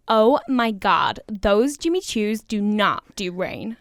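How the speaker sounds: put-on slow, drawn-out American girl; American accent. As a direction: play an American girl, stretched-out words. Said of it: "there's an American girl on that".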